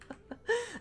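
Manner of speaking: soft high giggle; amused little high laugh. Real, not acted. Laughs at OnlyFans joke.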